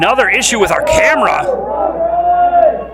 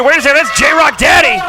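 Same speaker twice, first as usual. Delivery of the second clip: excited call; 6 semitones higher, 3 dB louder than usual. Real, not acted. Commentator spots surprise arrival.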